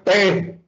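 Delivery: "pé" isolated and pitched high; stressed syllable alone. Shown as a demonstration. Teacher answers the quiz, "pé" stressed high. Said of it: "¿dónde está la sílaba tónica?".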